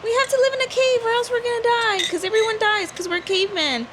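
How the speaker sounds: high-pitched mock-fearful dad voice; scared caveman dad. Caveman dad warning his daughter to stay inside, mock scared. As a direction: play an overprotective, scared cartoon dad. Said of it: "the dad is, like, scared".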